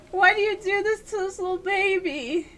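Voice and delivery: high, plaintive lament; pitying whine. Real, not acted. Player asking the game why it hurts a baby, high plaintive whine.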